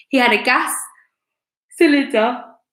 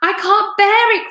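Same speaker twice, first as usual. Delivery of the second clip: high, louder cry of dismay; distressed cry; 10 semitones higher, 5 dB louder than usual. A narrator's reading. Wife crying out in horror at the disease. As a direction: cry out as if you can't stand it. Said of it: "cried Missus Twit".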